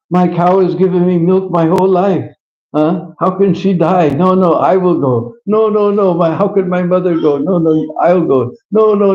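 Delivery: put-on a boy begging to go in her place; a young cowherd's shrill insistent no, no, no; excited cowherd boy. Cowherd boy argues to die.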